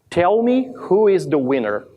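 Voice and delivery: usual speaking voice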